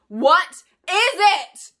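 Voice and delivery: very slow, high, loud scream; loud drawn-out shriek. Acted. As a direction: scream it across the burning room. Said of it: "Clarice was still screaming".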